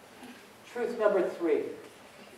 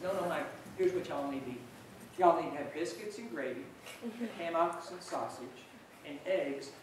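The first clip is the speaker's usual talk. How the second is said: a light, quiet put-on Georgia accent for a little boy; bad Georgia accent; 3 semitones lower, 12 dB quieter than usual. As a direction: speaker imitating a Georgia boy in a mild Georgia accent. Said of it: "I'm gonna massacre a Georgia accent".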